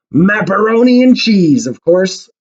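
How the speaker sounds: high, loud, drawn-out exclamation; pirate captain exclaiming. A narrator's reading. Pirate captain answers Molly about what pirates eat, exclaiming. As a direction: proclaim it slowly with big, high-pitched gusto. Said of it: "exclaimed the pirate captain".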